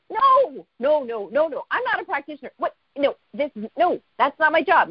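high opening no, then rapid-fire protests; incredulous refusal. Retold or imitated. Telling her mentor it's not her job, flustered refusal. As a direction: protest the very idea, retold comically. Retold refusal to become practitioner.

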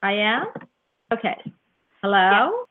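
normal talking voice

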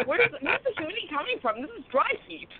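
high-pitched puzzled protest; mock bewilderment. Guest retells the heat confusion, mock-bewildered. Acted bewildered reaction in her story.

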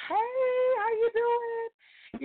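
high, long drawn-out hello; star-struck greeting. Retold or imitated. Fan saying hi to her favorite author, high and drawn out.

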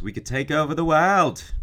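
high, loud, hammed-up Australian accent; horrible Australian accent. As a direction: proclaim it in a badly faked Australian accent. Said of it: "I do a horrible Australian accent".

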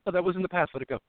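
high, airy voice of a dismissive spouse; mimicking the cheating wife; mock spouse. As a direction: brush it off in a high voice. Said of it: "the next day she'll say".

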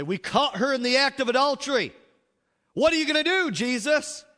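hauling her before Jesus and demanding a verdict; angry mob of accusers shouting; accusing Pharisees. Acted.

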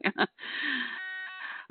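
high embarrassed laugh. Real, not acted. Laughing at the Cowboys' loss.

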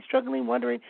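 usual speaking voice

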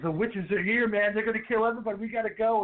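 put-on high, urgent freaked-out teen; panicked warning. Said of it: "Max is like".

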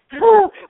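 a high, loud laugh that bursts out; burst of laughter. Real, not acted. Laughing at herself for not speaking sweetly. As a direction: laugh out loud at your own bluntness. Laughs at her own bluntness.

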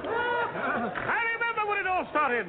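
high, slow and grand; dramatic restart. Acted. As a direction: start over, hammed up and lofty. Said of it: "I said it again".

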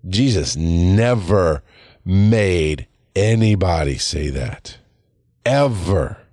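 slow, word-by-word insistence; weighty, spaced-out declaration; slow emphatic. Real, not acted. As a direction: drive it home slowly with gaps. Drives home his point, deliberately slow.

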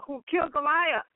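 usual speaking voice

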